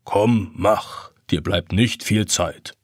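low, slow gentle urging; low clock voice. A narrator's reading. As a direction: ancient clock nudging him on. Grandfather clock urges.

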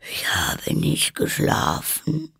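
slow, husky sleepy voice; hoarse, weak. A narrator's reading. Just-woken Dormouse denies sleeping, hoarse and weak. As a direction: croak it feebly and slowly. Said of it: "mit heiserer, schwacher Stimme".

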